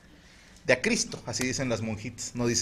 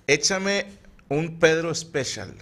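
higher and slow, drawn-out order; booming bar order; 7 semitones higher, 5 dB louder, much slower than usual. Retold or imitated. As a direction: stretch the order out like a tough guy at the bar. Drunk customer orders at bar. (pedido lento y alto, de cantina)

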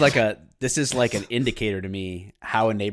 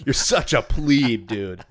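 mock insult; 5 dB louder, slower than usual. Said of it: "It's one of my favorite things".

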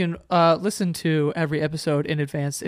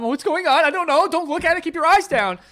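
panicked; 11 semitones higher, 4 dB louder than usual. Retold or imitated. Panicked movie people voiced.

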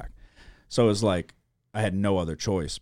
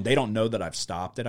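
normal talking voice

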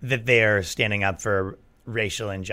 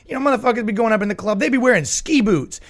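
higher, fast, hyped-up comic voice; imitating J B Smoove; 10 semitones higher than usual. To a friend, doing the comic's club bit in a high, fast hype voice. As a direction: rattle it off like a wired stand-up doing his bit. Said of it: "he goes".

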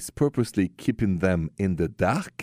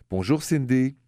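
ordinary talk, nothing marked

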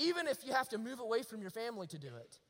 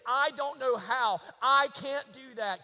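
louder, slow, pleading protest; overwhelmed protest; 3 semitones higher, 7 dB louder, slower than usual. Retold or imitated. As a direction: plead your inadequacy, slowly. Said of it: "what you would immediately say is".

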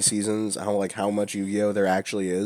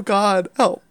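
ordinary talk, nothing marked

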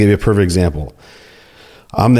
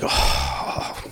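low, soft groaning sigh; exasperated sigh; 6 semitones lower, 9 dB quieter than usual. Retold or imitated. Officer told to crack the yard, exasperated sigh. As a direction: heave a put-upon sigh, imitating a sulker. Officer sulks at being ordered around.

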